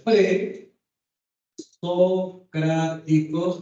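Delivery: presocráticos stretched slowly syllable by syllable; drawn-out pronunciation. Shown as a demonstration. Teacher dictating presocráticos slowly to the class. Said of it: "con tilde en la A, acento en la A".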